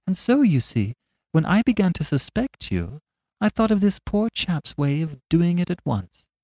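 high, mild and simple; meek little priest. Acted. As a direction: speak like a gentle country priest.

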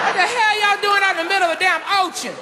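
high-pitched, outraged moviegoer yelling at the screen; heckling moviegoer. Acted. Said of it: "the brother started first. He was like".

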